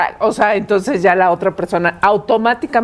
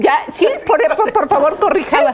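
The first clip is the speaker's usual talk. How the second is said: high pleading please-correct-it; pleading clerk request; 6 semitones higher than usual. Retold or imitated. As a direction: beg the clerk sweetly. Imitates pleading to bank clerk. (súplica aguda al empleado)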